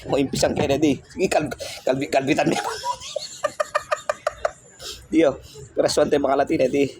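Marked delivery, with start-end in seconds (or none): 2.6-4.5 high giggle. Real, not acted. Laughing at pet's antics.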